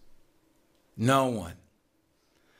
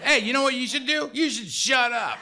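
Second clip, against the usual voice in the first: higher-pitched, irritated telling-off; irritated put-down; 7 semitones higher than usual. Retold or imitated. Sponsor to the newcomer bragging in his car, irritated. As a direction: cut him off with an exasperated put-down.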